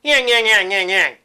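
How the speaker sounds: high, loud, forward witch yang; nasty witch sound placed in the head; cackling witch, focused bright and forward; witchy voice. Shown as a demonstration. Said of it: "focus the voice production right up in here in the head".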